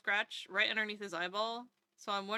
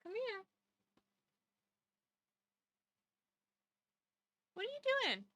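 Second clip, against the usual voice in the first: much higher, softer coaxing; pet talk; 7 semitones higher, 3 dB quieter, much faster than usual. Real, not acted. Owner coaxing her cat over, high soft pet talk. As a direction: coax a cat closer.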